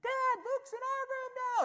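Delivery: put-on a kid complaining across the dark house; child calling out. Girl calls to Dad that the toddler got in again, wailing. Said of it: "I hear".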